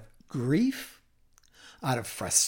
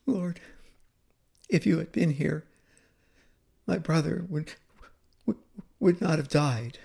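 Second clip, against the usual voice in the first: Mary; 2 semitones higher than usual. Acted. Mary at Jesus' feet mourning her brother, sobbing gently. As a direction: grieve it brokenly, stalling on "would". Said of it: "She spoke that through tears, through sobs".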